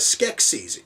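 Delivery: Skeksis ending in long EE plus Z; pronounced SKEK-seez. Shown as a demonstration. Reviewer quoting her second appearance in the film, Skeksis as SKEK-seez. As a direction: make Skeksis rhyme its ending with "seize". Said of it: "she is then pronouncing it as".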